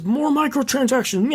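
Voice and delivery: high, exaggerated greedy villain voice; Bobby Kotick impression. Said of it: "that's my Bobby Kotick".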